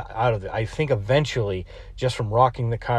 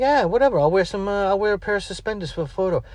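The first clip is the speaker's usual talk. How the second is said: put-on careless kid voice; 8 semitones higher than usual. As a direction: toss it off like a carefree kid. Quoting his careless kid self.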